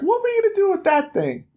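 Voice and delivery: high, fast disbelieving query; mock incredulous question. Bigsby questions Snow's giant sword.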